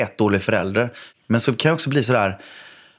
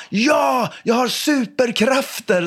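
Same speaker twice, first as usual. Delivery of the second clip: put-on high, slow, proud kid voice; proud child shout; 12 semitones higher, much slower than usual. Son boasting to dad, high proud child shout. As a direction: boast like a little superhero. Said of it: "sa han så här".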